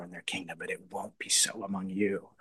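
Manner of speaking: sudden unvoiced whisper; whispered as a secret truth. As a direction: lean in and whisper it. Quoting Jesus on leadership.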